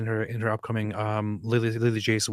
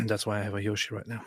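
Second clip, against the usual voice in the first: ordinary talk, nothing marked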